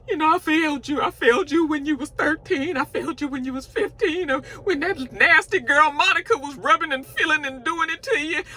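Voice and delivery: high, far put-on voice of a weepy mother; mock crying mom. Mocking the mom's tearful apology to her son, high put-on voice. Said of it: "she's all crying like".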